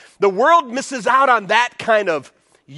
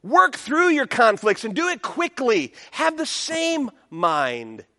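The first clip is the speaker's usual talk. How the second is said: urgent Paul; 7 semitones higher than usual. Retold or imitated. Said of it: "Paul's saying".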